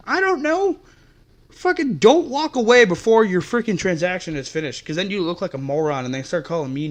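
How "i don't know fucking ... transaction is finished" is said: strained yell, much higher; exasperated shouted rant; angry shout. Real, not acted. Ranting at customers who leave.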